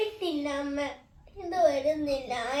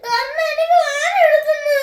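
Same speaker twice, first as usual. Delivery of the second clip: higher, louder wailing complaint; crying in pain; 9 semitones higher, 6 dB louder than usual. Real, not acted. Girl wailing to mother that it hurts. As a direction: wail that it hurts.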